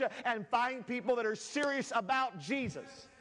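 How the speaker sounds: rhythmic, insistent pulpit delivery; fervent preaching. Real, not acted. Builds into driving preaching.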